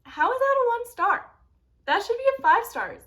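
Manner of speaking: high, loud disbelief; incredulous protest. Real, not acted. Protests a one-star rating.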